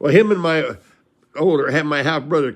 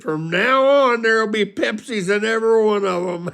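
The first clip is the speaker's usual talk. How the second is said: put-on crying voice, pitched way up; imitated tearful promise; 9 semitones higher, 4 dB louder than usual. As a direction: make a weepy, hammed-up drunken vow. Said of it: "And he starts crying".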